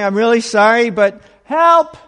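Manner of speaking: sudden loud, high cry for help; apologize, then blurt out help in alarm; panicked plea. Retold or imitated. To the deities while freaked out, a loud panicked plea.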